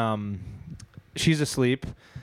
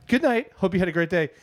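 higher, chirpy assistant voice; 4 semitones higher than usual. Retold or imitated. Alexa answering the late-night test, voice assistant. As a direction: chime it like a polite device. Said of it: "and the Alexa goes".

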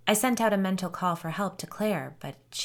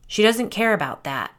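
mild, louder and higher snap; snapped; 4 semitones higher, 7 dB louder than usual. A narrator's reading. Doug cuts in on Alicia about screen time, snapping. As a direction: snap it out impatiently. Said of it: "Doug snapped".

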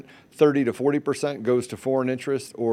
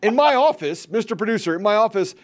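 much higher, exaggerated office talk; mock pompous boss; 8 semitones higher than usual. Host imitating guest's office boast, pompous and high. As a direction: play the self-important executive.

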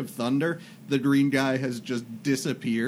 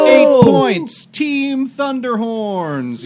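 loud, slow, much higher announcer call; sports announcer; 12 semitones higher, 13 dB louder, much slower than usual. Pretend. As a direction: boom it out like a stadium announcer. Announcing the game score.

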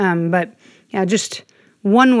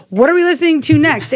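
mock impatient quote; 8 semitones higher, 3 dB louder, faster than usual. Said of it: "I'm like".

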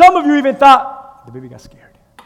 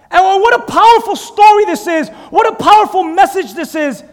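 normal talking voice

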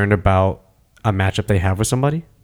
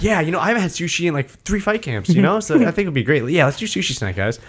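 casual chit-chat; 7 semitones higher, faster than usual. Retold or imitated. Relaxed fighter chatting in corner.

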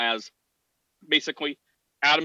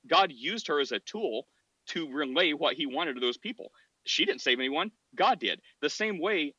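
ordinary talk

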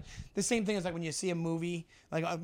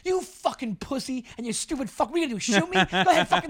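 high-pitched mock road-rage yelling, building louder; imitated angry yelling; 9 semitones higher, 5 dB louder than usual. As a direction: act out a hothead yelling from his car. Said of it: "he just starts yelling".

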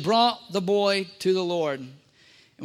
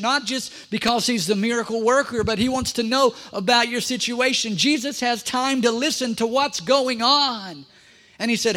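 normal talking voice

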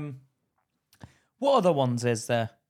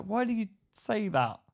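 Charlotte; 7 semitones higher than usual. Retold or imitated. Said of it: "Charlotte was like".

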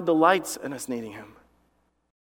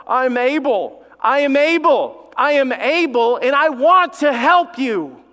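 emphatic declaration; 9 semitones higher, 6 dB louder, slower than usual. Acted. God's voice declaring ability.

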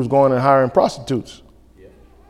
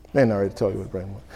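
fast, low and soft grumble; muttered repeat; 9 semitones lower, 12 dB quieter, much faster than usual. Acted. Preacher grumbles to the congregation, quiet and fast. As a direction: mutter it under your breath.

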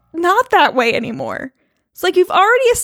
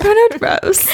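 mock English accent; 8 semitones higher, slower than usual.

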